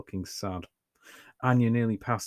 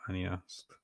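usual speaking voice

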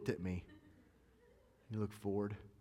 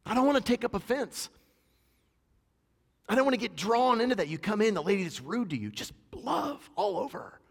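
higher, louder full-force preaching; fiery preaching; 9 semitones higher, 6 dB louder than usual. Acted. Preacher exhorting the congregation to love, at full force.

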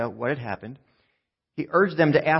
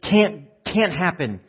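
raised, exasperated protest; fed up; 5 semitones higher, 4 dB louder than usual. Acted. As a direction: refuse loudly, patience used up. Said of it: "they're fed up".

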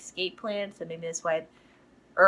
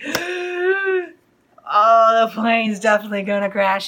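alarmed realization; 3 semitones higher, 9 dB louder than usual. Retold or imitated. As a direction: blurt it out in retold alarm.

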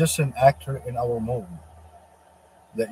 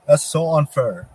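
put-on whiny man child; 3 semitones higher, 7 dB louder, slower than usual. Devil voiced complaining to God.

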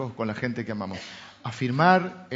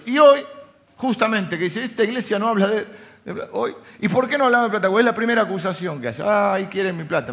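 higher, faster preaching; fervent preaching; 5 semitones higher, 5 dB louder than usual. Acted. Pastor bringing up money with his church, fast fervent preaching. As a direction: push it out with urgency, faster. Preaching at full force again.

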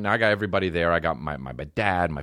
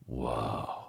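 hushed, much softer breath of awe; awed whoa; 11 semitones lower, 11 dB quieter than usual. Retold or imitated. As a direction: gasp at the golden hall. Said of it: "I'm like".

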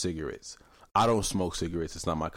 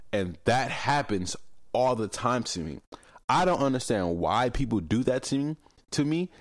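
ordinary talk

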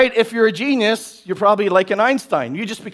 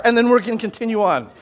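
usual speaking voice

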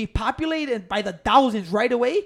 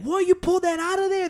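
mock shocked passerby, much higher; 8 semitones higher than usual. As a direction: play a startled stranger on the street. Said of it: "they'll get so mad".

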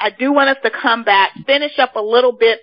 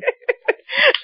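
high squealing laugh. Real, not acted. Host laughing.

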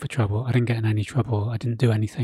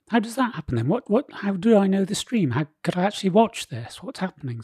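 imitating a baffled policeman, pitched up; high-pitched run of mock-puzzled questions; puzzled copper; 8 semitones higher than usual. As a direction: play a copper who doesn't get it. Retold copper baffled by live stream.

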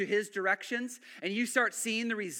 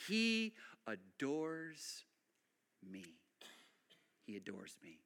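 slow, soft, amazed; awed hush; 4 semitones lower, 9 dB quieter, slower than usual. Retold or imitated. Peter hauling fish, softly amazed at God's care. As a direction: murmur it in quiet wonder. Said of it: "he's going".

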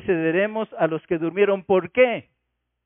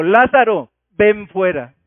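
loud, forceful voice of command; booming summons, each part punched out; commanding shout; 8 dB louder, slower than usual. Acted. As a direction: call out like a general ordering troops. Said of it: "me puede recordar la voz de mando".

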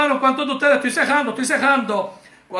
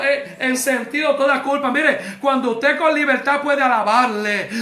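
ordinary talk

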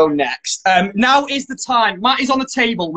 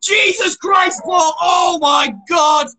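high, drawn-out horrified yells; shocked shouting; 8 semitones higher, slower than usual. Real, not acted. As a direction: shriek in disbelief, stretched out. Watching the wax strip ripped off.

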